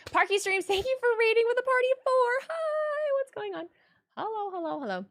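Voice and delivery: high, loud hype welcome; excited greeting. Real, not acted. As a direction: greet the raiders with a burst of energy. Greeting raiders on stream.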